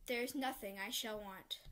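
ordinary talk

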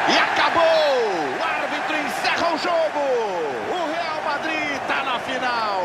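loud, high final whistle call; shouted match-winning call. Acted. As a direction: yell that the game is over.

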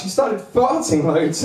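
usual speaking voice

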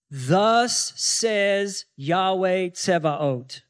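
very slow, weighty, each word set apart; solemn proclamation. Acted. The angel speaks to Joshua as the Lord, solemn slow proclamation. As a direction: declare it like a royal decree.

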